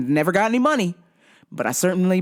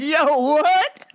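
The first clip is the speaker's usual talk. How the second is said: surprised; 11 semitones higher, 4 dB louder than usual. Retold or imitated. Laughing disbelief at video.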